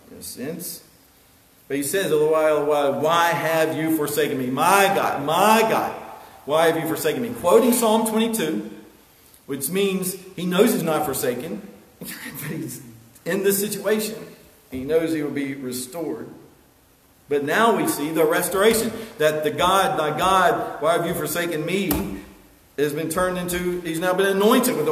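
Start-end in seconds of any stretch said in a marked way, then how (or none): 2.1-7.3 loud, raised-pitch quoted cry. Acted. Jesus on the cross calling to his God, crying out. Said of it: "he cries out". 19.5-22.2 loud, higher quoted cry. Acted. Preacher re-voicing the cry from the cross, crying out. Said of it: "he cries out".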